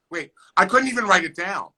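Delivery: normal talking voice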